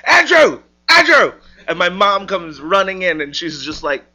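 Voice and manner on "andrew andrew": loud, high-pitched holler; urgent shouted call; excited yell. Retold or imitated. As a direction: yell the name from the back of the house. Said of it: "like, yell from the back".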